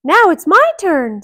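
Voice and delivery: high, loud mouse voice; Mickey voice. Acted. Mickey taking his turn, high voice. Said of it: "said Mickey to the giant".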